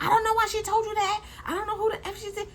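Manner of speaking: high, hammed-up shouting mother-in-law; shrill-free screech of an angry mom, played up; mock screaming mom. Vlogger imitating the mom yelling through the door, hammed-up and high.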